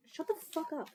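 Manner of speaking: retold comeback, hammed up; mock snap. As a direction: fire it back at the people judging the laugh. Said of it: "and I'm like".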